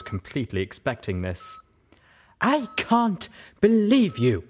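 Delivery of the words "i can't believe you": high, loud and slow, nearly shouting; furious mare nearly shouting at her friend. Acted. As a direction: let the anger almost burst out. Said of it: "she nearly shouted".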